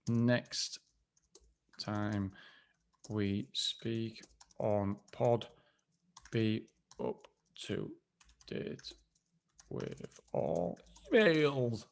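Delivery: slow, soft, drawn-out dictation; dictating as he writes. Real, not acted. Jotting his to-do note, dictating slowly and softly. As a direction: spell each word out slowly as you write it down. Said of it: "I'm actually gonna write the".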